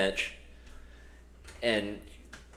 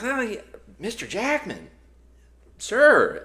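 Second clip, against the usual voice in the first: high-pitched, flustered fan; mock starstruck; 12 semitones higher, 5 dB louder, slower than usual. Podcaster answering a film star, hammed-up starstruck. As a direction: squeak it out, overawed by the star. Acting himself meeting a star.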